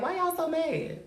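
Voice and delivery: high sing-song mock question; high teasing taunt. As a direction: tease them, pitch it way up.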